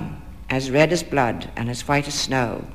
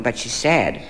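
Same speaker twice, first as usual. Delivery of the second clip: usual speaking voice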